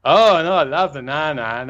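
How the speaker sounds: exaggerated Southern twang, teasing; mock Southern accent. [name] taking the mickey out of the golfer, mock Southern accent. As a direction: mimic a Southern accent to poke fun. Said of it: "he starts taking the mickey out of him".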